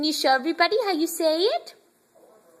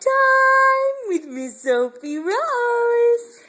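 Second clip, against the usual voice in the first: announcer intro; 6 semitones higher, 3 dB louder, much slower than usual. Acted. Opening intro to baby snack video.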